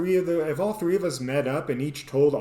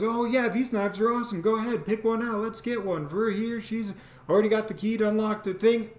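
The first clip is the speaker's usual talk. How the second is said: higher, bubbly and encouraging; high eager girlfriend voice; 7 semitones higher than usual. Retold or imitated. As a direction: chirp it like an excited girlfriend. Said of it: "she comes up and goes".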